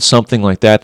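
normal talking voice

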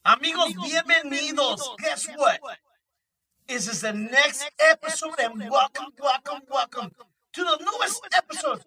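loud energetic host welcome; hyped host. Real, not acted. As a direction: pump up the crowd.

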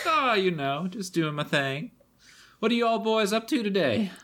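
a light, sing-song barmaid; friendly barmaid greeting the party. Acted. Barmaid NPC voice.

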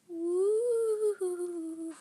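high, loud, drawn-out spooky wail; icy ghostly call. A narrator's reading. Monster calling from under bed, icy wail. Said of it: "an icy voice called".

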